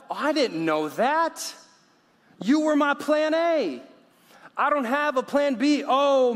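hammed-up panic, very high and loud; mock panic. God discovering Moses' flaw with no plan B, mock panic. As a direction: wail it in exaggerated dismay, climbing high.

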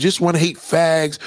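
ordinary delivery

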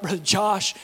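ordinary delivery

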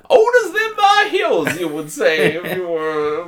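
very high, loud cartoon cowboy; over-the-top old prospector cowboy accent. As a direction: stretch it out in a hammed-up cowboy holler. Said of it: "talking in a cowboy accent".

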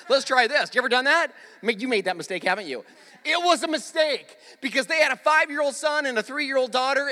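usual speaking voice

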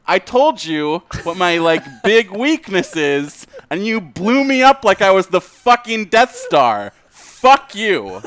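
loud, high, laughing mock anger; yelling in fake hurt while laughing; mock indignation. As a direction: ham up the betrayal. Mock outrage at cohosts.

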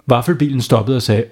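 ordinary delivery, nothing marked

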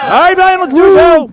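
high, loud showtime call; hype call. Real, not acted. Host launching the show to the crowd, loud call. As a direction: kick it off with a bang.

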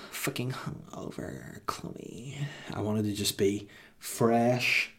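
soft whiny reality-star voice; put-on celebrity voice. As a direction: drift on in the mock diva voice. Keeps the put-on voice.

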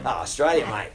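hammed-up Australian accent; bunged-on Australian accent. Actor answering where he is from, broad Australian accent. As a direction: lay the Australian accent on thick. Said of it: "they really bung it on".

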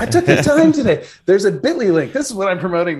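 high, laughing, pleased with himself; excited. Real, not acted. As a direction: announce it proudly, laughing. Proud announcement with laugh.

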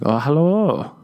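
high, swooping mimicked greeting; flirty put-on voice. Comedian answering the admiring woman in kind, flirty. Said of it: "Why are we talking like this?".

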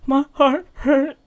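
high, slow mock sobbing; hammed-up tearful kid wail; mumbled through tears. Heartbroken boy telling his brother through tears, mock sobbing. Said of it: "I mumbled through tears".

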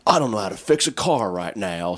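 a put-on voice, lightly shifted from his own; voicing his helpless inner macho self; changed voice. Guy stuck by a flat tire, lightly put-on voice. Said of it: "I don't know why I changed my voice there".

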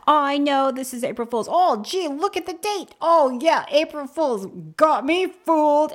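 high, loud sing-song mock voice; mocking fake realization. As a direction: ham up pretending to just notice the date. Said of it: "you just walking around saying that".

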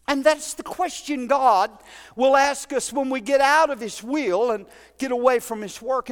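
usual speaking voice

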